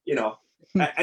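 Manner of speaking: ordinary delivery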